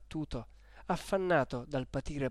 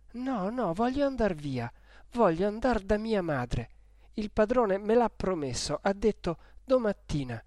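put-on high, pleading young woman, near tears; frightened pleading; 6 semitones higher, 3 dB louder than usual. Lucia begs the old woman to let her go, frightened. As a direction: beg to be taken home to mother.

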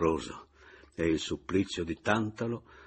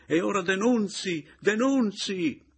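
very high, loud, drawn-out yell; shrieking; 13 semitones higher, 5 dB louder than usual. A narrator's reading. Brother yelling from the other room, shrieking. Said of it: "il fratello strillava di là".

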